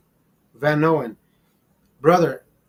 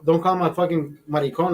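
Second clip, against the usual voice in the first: usual speaking voice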